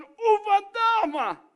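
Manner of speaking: alarido agudo, fuerte y lento; grito de terror. Acted. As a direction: grita aterrado como quien ve un fantasma. (discípulo aterrado que grita agudo y fuerte)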